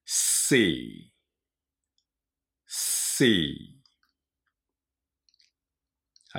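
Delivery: "シー" starting with a long airy s, not sh; "シー" as English "sea", falling after a hissed s. Shown as a demonstration. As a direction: open "シー" like air leaking from a tyre. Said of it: "あれをイメージして音を出してください".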